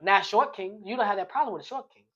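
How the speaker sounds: ordinary talk, nothing marked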